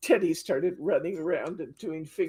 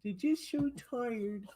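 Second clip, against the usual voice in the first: high, soft tired puppy voice; whiny sleepy pup; voicing the dog; 7 semitones higher, 4 dB quieter than usual. Owner voicing the tired poodle to viewers, high and soft. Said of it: "He said".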